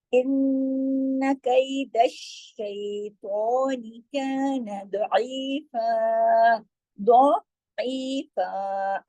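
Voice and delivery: very slow, drawn-out recitation; slow Quranic recitation. Shown as a demonstration. Teacher reciting the verse for students, slow chant. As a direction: chant the verse slowly with long vowels. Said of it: "leads recitation of the verse".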